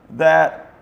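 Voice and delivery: ordinary talk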